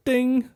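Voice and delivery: high mock notification ding; phone ding imitation. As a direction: chirp it like a phone alert. Phone alert noise imitated.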